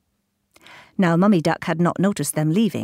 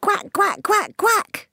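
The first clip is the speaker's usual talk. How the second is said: mummy duck quacking; slower than usual. A narrator's reading. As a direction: quack high to call the ducklings home. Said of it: "took a big deep breath".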